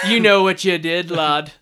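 loud, hammed-up Irish accent; put-on Irish accent, mock accusation. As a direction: play a scolding Irishman, accent laid on. Acts an Irish attacker on landing.

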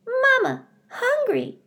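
very high, tiny chick peep; peeping gosling. A narrator's reading. Brightbill peeps to Roz for food, very high. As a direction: peep it like a hungry baby bird. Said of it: "Brightbill started peeping".

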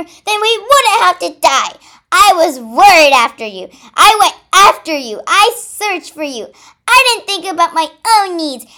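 high, very loud quarrelling shout; angry yelling. A narrator's reading. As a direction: yell accusations at your sister at full volume. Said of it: "yelled Pink Ruby".

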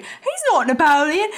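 high, loud goofy cartoon sidekick; Pinky. Host imitates Pinky objecting to the crowd, high goofy voice. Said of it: "That was a pretty good Pinky impression".

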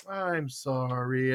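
slow, soft, low apology; mock contrition. Dad apologizing before punching the doll, mock contrite. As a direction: draw it out, falsely sorry. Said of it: "And I'll go".

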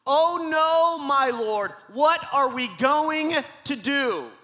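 high, loud dramatized cry of dismay; crying out in alarm. A narrator's reading. Servant crying out to Elisha at the surrounding army, alarmed. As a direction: wail it out slowly at the end, reading dramatically. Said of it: "he cried out to Elisha".

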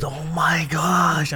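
dad stunned by his son's shot, slow amazed exclaim. Retold or imitated. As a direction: draw it out, thrilled and stunned. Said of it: "He goes".

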